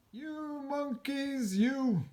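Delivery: high, slow scolding voice; scolding peddler. Acted. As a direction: wag a finger and scold the monkeys. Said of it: "he said, shaking a finger".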